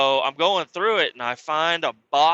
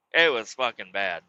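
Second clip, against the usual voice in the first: normal talking voice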